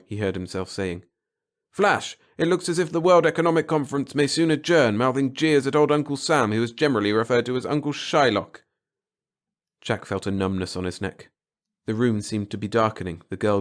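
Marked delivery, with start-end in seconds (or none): 1.7-8.6 put-on high, loud newsreel announcer from an old speaker; grating radio voice. Old radio announces the economic conference, high newsreel voice. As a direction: read it like a tinny radio bulletin. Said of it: "the grating radio voice".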